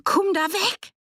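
very high, desperate scream; shrieked, panicked command. A narrator's reading. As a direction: scream an order to get away. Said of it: "Anni sah das und schrie".

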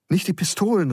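put-on high, alarmed boy's plea; startled, urgent protest; startled cry. A narrator's reading. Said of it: "rief Geronimo erschrocken".

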